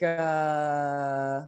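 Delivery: normal talking voice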